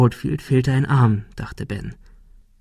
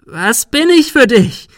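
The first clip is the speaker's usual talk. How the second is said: very high, loud, drawn-out screech; shriek; 12 semitones higher, 7 dB louder, slower than usual. A narrator's reading. Girl confronting the boy in hiding, shrieking. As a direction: screech the question at him. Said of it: "Sarah kreischte".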